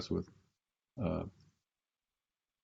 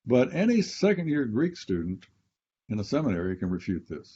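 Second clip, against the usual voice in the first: ordinary talk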